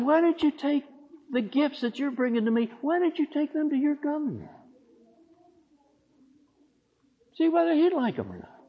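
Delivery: high, mocking sarcastic voice. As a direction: sneer it up high, mocking the offering. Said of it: "God gets downright sarcastic".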